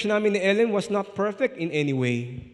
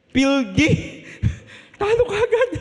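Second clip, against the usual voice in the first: much higher, laughing outcry; laughing exclaim; 4 dB louder than usual. Real, not acted. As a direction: crack up at being shot down. Laughing at his own rejection.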